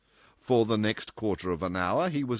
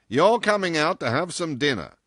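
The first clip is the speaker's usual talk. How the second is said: loud, higher character voice; bluff, commanding boss; 8 semitones higher, 7 dB louder than usual. A narrator's reading. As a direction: announce it as an order. John Dene's bluff invitation.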